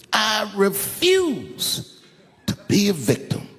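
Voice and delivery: high, drawn-out mock Chris Rock; imitating Chris Rock. Comic quoting Chris Rock's line to the Baltimore crowd, high and drawn out. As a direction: stretch I refuse slowly like a punchline. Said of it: "He said".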